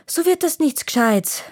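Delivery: high, bossy little elf call. A narrator's reading. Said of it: "rufte Tjovik".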